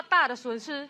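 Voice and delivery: ordinary talk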